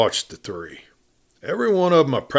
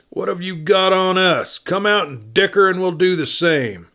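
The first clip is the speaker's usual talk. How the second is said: higher, bold hail to the lawman; outlaw calling out; 8 semitones higher than usual. A narrator's reading. Outlaw daring the sheriff to come out and bargain. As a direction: call out a dare to parley.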